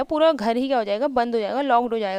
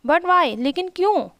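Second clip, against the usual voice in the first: surprised mother; 6 semitones higher, slower than usual. Acted. Mother's surprised question voiced.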